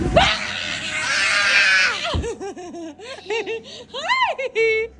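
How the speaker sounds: sudden laughing scare; startling boo. Real, not acted. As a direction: jump out with a playful laugh. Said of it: "Did I scare you?".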